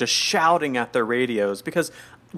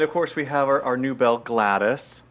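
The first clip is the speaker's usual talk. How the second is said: usual speaking voice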